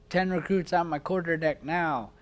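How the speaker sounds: barked drill instructor order; drill instructor command. Retold or imitated. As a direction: bark it at the recruits.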